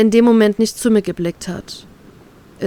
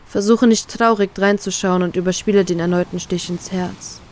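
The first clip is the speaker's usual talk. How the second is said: ordinary delivery, nothing marked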